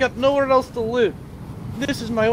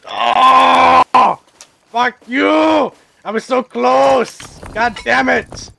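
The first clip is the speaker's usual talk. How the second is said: loud, raging shout; furious outburst; 4 semitones higher, 9 dB louder, much slower than usual. Real, not acted. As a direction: yell it out in real rage. Base raided, he erupts.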